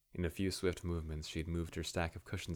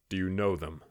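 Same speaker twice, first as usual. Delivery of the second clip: cold, hard question; a cold, louder demand; 7 dB louder than usual. A narrator's reading. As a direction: ask it like ice. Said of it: "his words colder than the outside air".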